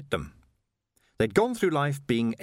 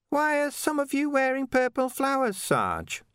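put-on much higher, nervous young voice; a timid junior asking his sergeant; young corporal. Young Corporal Ping asks.

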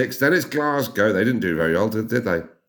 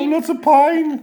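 much higher, slow, sing-song Midlands mimic; mock Midlands voice; 5 dB louder than usual. Teasing back in a high Midlands mimic. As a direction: echo her in a singy Midlands lilt.

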